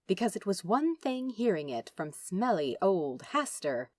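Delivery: ordinary delivery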